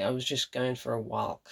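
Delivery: "walk" with an audible L before the K; pronounced L. Retold or imitated. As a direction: imitate him sounding the L in "walk". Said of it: "He actually pronounces the L".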